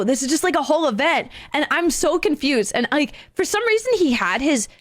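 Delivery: ordinary talk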